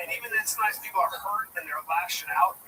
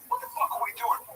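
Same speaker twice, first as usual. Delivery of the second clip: high, incredulous mock question; retold disbelief; 14 semitones higher, faster than usual. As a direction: ask it high, baffled at the silliness. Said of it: "it's already like".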